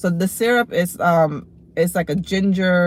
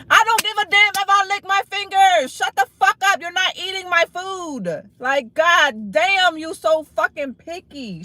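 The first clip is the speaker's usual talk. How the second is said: high-pitched, real exasperated yelling; angry rant at chat; 7 semitones higher than usual. Real, not acted. Fed up with chat, she rants at them in a high angry voice. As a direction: snap back hard at the trolls. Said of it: "telling me to relax".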